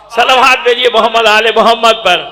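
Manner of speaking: loud call for salawat; congregational call. Real, not acted. Preacher asks the congregation for blessings, loud rallying call. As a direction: call out to the whole gathering.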